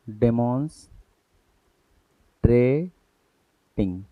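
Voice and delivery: dictation-pace डेमोन्स्ट्रेटिंग; slow spelled-out word. Shown as a demonstration. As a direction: stretch डेमोन्स्ट्रेटिंग out for students to copy. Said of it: "अब हम पहला बनाएंगे".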